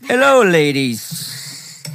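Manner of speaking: slow, drawn-out spooky greeting; stretched out. Acted. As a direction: stretch every word out. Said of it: "stretch it out".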